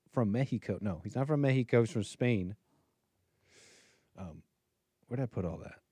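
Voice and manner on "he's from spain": ordinary delivery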